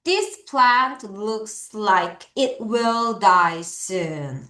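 very slow, word by word read-along; slow choral reading. Shown as a demonstration. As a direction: read it slowly so the class can follow. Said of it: "자, 같이 읽어요". (아주 천천히 또박또박 같이 읽기)

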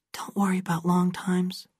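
girl's soft whisper, hushed and unvoiced. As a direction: breathe it secretly like sharing a hiding place. Girl soothes boy under tree.

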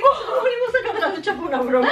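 high laughing through the words. Real, not acted. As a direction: giggle while admitting it. Laughing at prank outcome.

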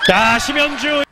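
high, loud shout of the name; excited player call. Real, not acted. Commentator hailing the player, high excited shout. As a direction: call out the player mid-rally.

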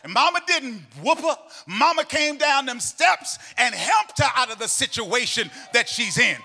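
loud, sung, whooping preacher cadence; full-force preaching. Acted. Preacher urging the congregation to praise, loud sung preaching. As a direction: build the church up to a shout.

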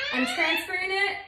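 strained, grunting mid-exercise; effort grunt. Real, not acted. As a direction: push the words out through the strain. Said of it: "Sorry, I'm grunting".

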